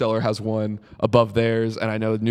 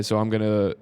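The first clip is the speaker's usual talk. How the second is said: usual speaking voice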